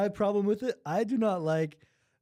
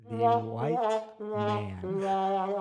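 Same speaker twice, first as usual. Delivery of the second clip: very slow drawl; 2 semitones higher, much slower than usual. Pretend. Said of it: "mock announcing, drawn out".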